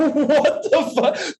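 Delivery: high, slow, drawn-out laugh through the words; laughing disbelief. Real, not acted. Hearing why Joey made a scene, laughing high disbelief. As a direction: let the laugh take over the outrage. Hearing the wrong-wine reason.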